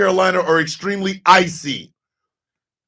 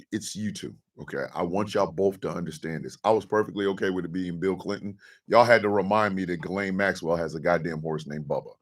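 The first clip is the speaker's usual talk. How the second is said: mock menacing voice; 10 semitones lower, 10 dB quieter than usual. Low menacing scolding of chatters.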